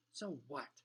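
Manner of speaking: plain reading voice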